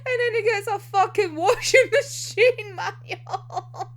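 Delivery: words broken by laughter, slowed; laughing through words. Real, not acted. Telling viewers about the manual, laughing through it. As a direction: laugh your way through the punchline.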